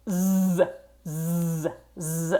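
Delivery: held voiced zzz, buzzing. Shown as a demonstration. Teacher shows the buzz for Z. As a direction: buzz a long Z from the voice box. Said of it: "making a vibration here in your voice box".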